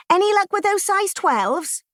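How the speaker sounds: call from the shop. Retold or imitated. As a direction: call out across the shop floor. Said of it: "came a call from out front".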